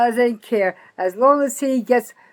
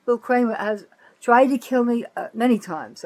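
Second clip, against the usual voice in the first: ordinary talk, nothing marked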